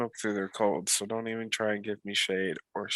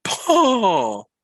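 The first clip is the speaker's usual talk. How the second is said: imitating a toddler yelling the name, much higher and louder; excited toddler shout; 13 semitones higher, 9 dB louder, much slower than usual. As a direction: toddler thrilled to go see his grandpa. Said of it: "And he's like".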